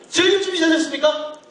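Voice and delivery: high, projected call to the back rows; rousing crowd call. Real, not acted. Calls again to the back rows. (뒤쪽 관객을 향한 힘찬 외침)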